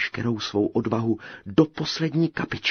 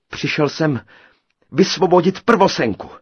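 louder, higher, bold declaration; bravely; 8 semitones higher, 10 dB louder than usual. A narrator's reading. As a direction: stand tall and declare it. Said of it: "řekl Krejčí srdnatě". (srdnatě, hlasitě)

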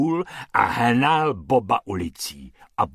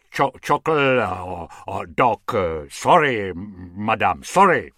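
halting, stuttered search for words; flustered, fumbling apology with loud bursts; stumbling English apology; 3 semitones lower, 4 dB louder than usual. A narrator's reading. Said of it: "pak to zkouší i anglicky".

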